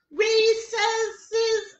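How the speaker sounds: high, loud teacher yell; high teacher shout. A narrator's reading. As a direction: call the class in across the playground. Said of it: "she yells".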